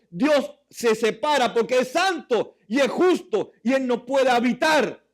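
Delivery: high, loud preaching; fiery sermon delivery; preaching at full force. Acted. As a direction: preach it out to the congregation.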